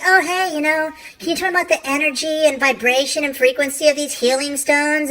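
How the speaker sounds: high, eager mock customer; curious customer. Customers asking about healing stones, high eager. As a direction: ask eagerly about crystals. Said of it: "people come up to me and say".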